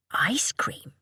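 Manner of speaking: put-on whispered question to a small child; coaxing whisper. A narrator's reading. Mother to toddler at her collarbone, coaxing whisper. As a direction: whisper it as a tempting offer.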